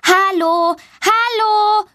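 hoch gerufenes Hallo; laut rufend. A narrator's reading. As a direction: ruf den Kinderstimmen hinterher. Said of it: "ruft sie laut". (Mädchen ruft den Kindern draußen zu, hoch und rufend)

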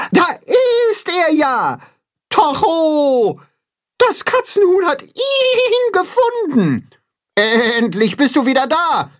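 very high, drawn-out joyful cries; excited goats calling. A narrator's reading. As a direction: call out like delighted bleating goats. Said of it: "Die Ziegen rufen alle gleichzeitig durcheinander".

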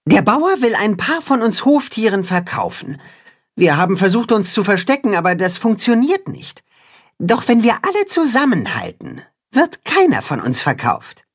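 rally the farm animals; a hint of a brave little cat-hen; cat-hen character. A narrator's reading. Cat-hen voiced by narrator.

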